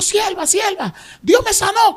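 high, loud joyful cry of the good news; overjoyed shout. Retold or imitated. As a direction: shout it out full of joy to the servant.

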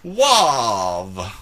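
loud, drawn-out comic clergyman; Princess Bride priest. Acted. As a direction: stretch the word like a pompous priest. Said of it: "Have you ever seen Princess Bride".

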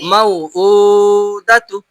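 মাউউদাতু read very slowly, the উ held long; slow, deliberate reading with a long উ; stretched vowel. Shown as a demonstration. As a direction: draw out the উ in মাউউদাতু. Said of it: "টান হলো।".

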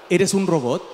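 the captcha page asking, mechanical; robotic website voice. Acted. Webpage asks the user, in a low robotic voice.

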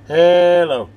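ordinary talk

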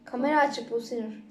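ordinary delivery

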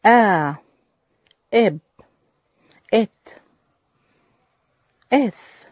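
each Arabic letter sound said alone, loud and clipped; letter sounds drilled. Shown as a demonstration. Teacher drilling the alphabet sounds for children, loud and slow. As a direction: sound out آ، إب، إت one by one. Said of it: "رددوا معي".

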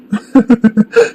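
high, drawn-out derisive laugh; mocking laugh. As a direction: laugh loftily at the very idea. Scoffs at talk of her studying.

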